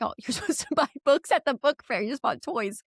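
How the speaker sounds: high, exasperated scolding with a laugh; half-laughing frustration at the kids. Retold or imitated. Mom scolding kids over book fair toys, exasperated. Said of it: "I was so frustrated".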